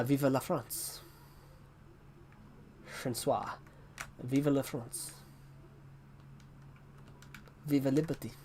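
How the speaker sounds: quiet French-accented phrases. Shown as a demonstration. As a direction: say vive la France with a French accent. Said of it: "I can do a bit of a French accent".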